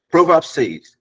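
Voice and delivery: normal talking voice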